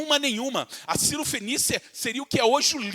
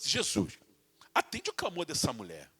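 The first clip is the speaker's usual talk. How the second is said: gentle plea; 4 semitones lower, 7 dB quieter than usual. Retold or imitated. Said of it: "Se eles fossem homens de coração bom".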